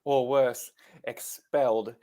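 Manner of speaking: lightly imitated British schoolgirl; a hint of a British accent, measured and deliberate; Hermione Granger impression. Co-host borrowing a film line to find the British accent, light impression. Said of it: "Do Hermione Granger".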